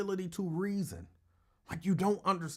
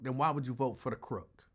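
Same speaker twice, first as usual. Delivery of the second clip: normal talking voice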